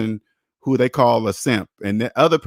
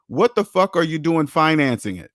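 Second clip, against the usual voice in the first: ordinary delivery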